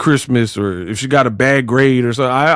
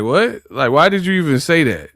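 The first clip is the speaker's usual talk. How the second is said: usual speaking voice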